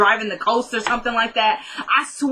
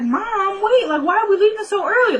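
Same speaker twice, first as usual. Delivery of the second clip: high-pitched imitation of protesting children; put-on child's protest, pitched way up; complaining kids; 11 semitones higher than usual. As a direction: mimic kids objecting to their mom. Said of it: "That's what all the kids are doing".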